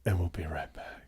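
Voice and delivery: low whispered aside; whispered sign-off. Real, not acted. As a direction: whisper it as the break starts. Signing off before a pause.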